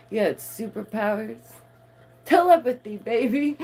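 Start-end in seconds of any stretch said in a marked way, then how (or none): 2.2-3.6 much higher and louder, hyped; hype telepathy. Real, not acted. Hyping mind control to viewers, loud excited. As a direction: sell it like a hype man. Catchphrase repeat.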